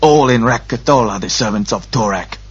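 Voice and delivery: stern temple guard's voice; harsh-voiced masked priest; Grolem priest. A narrator's reading. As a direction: rebuke them as a harsh priest. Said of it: "the Grolem said".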